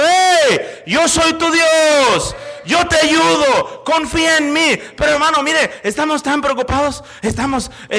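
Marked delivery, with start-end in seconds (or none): 0.0-4.8 high, loud, drawn-out proclamation; God calling out. Acted. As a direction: shout as God to a panicking believer. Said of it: "Y Dios está diciendo". (Dios proclamando a gritos)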